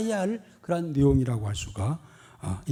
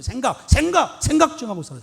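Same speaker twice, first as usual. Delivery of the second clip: scolding; 7 semitones higher, 7 dB louder than usual. Real, not acted. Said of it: "preacher scolding the congregation".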